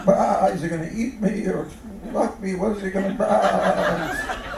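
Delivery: mock-worried animal thinking aloud; nervous sheep.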